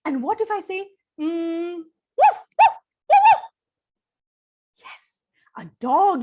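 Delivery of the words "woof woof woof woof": high loud woof woof; high yappy barks; dog barking. Acted. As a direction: bark like an eager little dog.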